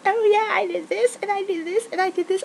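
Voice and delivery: very high, squeaky mocking brag; mocking braggart. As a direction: mimic the coworker boasting about credit. Mocking coworkers taking the credit.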